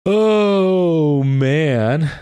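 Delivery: high, stretched-out amused exhale of words; amused exclamation. Real, not acted. Streamer winding down from a big laugh, amused. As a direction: let it out long and high after laughing.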